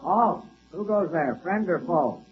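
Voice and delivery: slow, soft guard's challenge; vault guard challenge. Acted. Guard challenging whoever comes to the vault, slow and hushed. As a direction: call out quietly as a guard in the dark.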